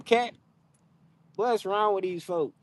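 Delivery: usual speaking voice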